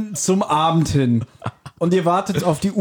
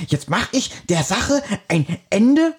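langsam und etwas höher, gespielt entschlossen; entschlossener Held; 4 semitones higher, slower than usual. Retold or imitated. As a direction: wie ein Hörspielheld, der zur Tat schreitet. Peters Satz im Hörspiel nachgespielt. (Peter aus dem Hörspiel nachspielen, entschlossen und gedehnt)

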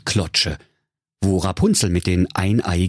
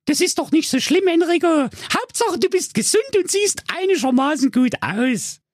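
put-on much higher cheeky young girl voice; Rapunzel. Rapunzel mocking the witch, high-pitched girl voice. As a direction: play Rapunzel high and sassy. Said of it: "Rapunzel sprach".

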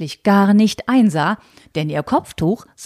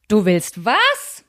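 slow, stunned outraged question; shocked old aunt; aghast disbelief; 3 semitones higher, much slower than usual. Acted. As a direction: drag it out in horror. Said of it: "so weit außer sich wie nie zuvor".

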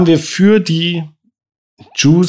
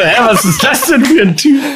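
high, giggling mock confusion; laughing quote; 10 semitones higher than usual. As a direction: quote him while laughing. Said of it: "Der dachte so".

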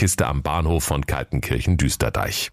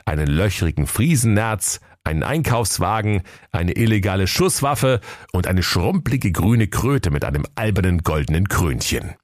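ordinary delivery

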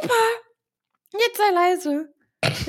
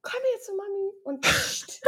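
soft coaxing; 4 semitones higher, 11 dB quieter than usual. Real, not acted. Coaxing the dog quietly.

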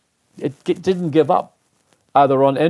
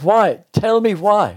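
higher, pressing demand for a reason; insistent demand; 7 semitones higher, much slower than usual. Retold or imitated. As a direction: push him hard to explain, higher pitched. I'm jabbing my finger in my.

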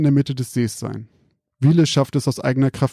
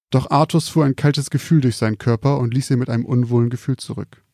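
ordinary delivery, nothing marked

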